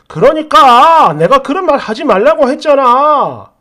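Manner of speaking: loud, irritated outburst; exasperated husband. Acted. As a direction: tell your partner off, fed up. Acted husband snapping back in quarrel.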